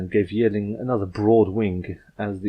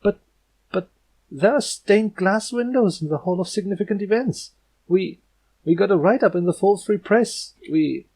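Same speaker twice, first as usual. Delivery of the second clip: higher, stammering, flustered protest; sputtering protest; 11 semitones higher, 4 dB louder than usual. Acted. Twilight insists she saved the world, sputtering. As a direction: sputter to prove yourself. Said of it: "Twilight sputtered a bit".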